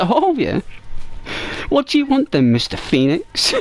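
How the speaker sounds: high, teasing salesman's charm; playful phone charm. Retold or imitated. Salesman charming a caller from Phoenix, high and teasing. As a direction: flirt a little with the caller.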